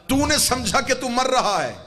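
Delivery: high, loud, ringing sermon climax; full-force preaching. Real, not acted. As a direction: drive it home to the whole congregation.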